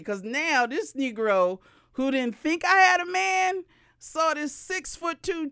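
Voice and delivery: ordinary delivery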